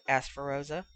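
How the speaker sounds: ordinary delivery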